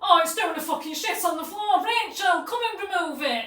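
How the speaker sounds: high, loud, panicky imitation; acted frantic yell. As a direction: shout for help in mock desperation. Retold frantic plea about horse.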